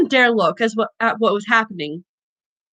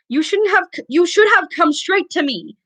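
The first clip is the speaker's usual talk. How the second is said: raised high, scolding hard; raging nurse; 6 semitones higher than usual. A narrator's reading. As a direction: tear into him for waiting. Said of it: "she raged".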